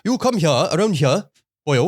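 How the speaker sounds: a hint of a Welsh local calling a boy over; Welsh accent. Mimicking a Welsh man calling a boy, in a light Welsh accent. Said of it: "Sorry, just Welsh".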